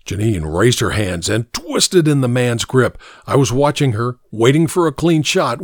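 usual speaking voice

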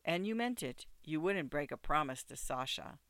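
ordinary delivery, nothing marked

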